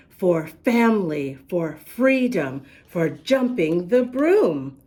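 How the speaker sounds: slow, clear storybook reading; reading to children. Acted. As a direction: read the rhymes to little listeners. Reads the picture book aloud.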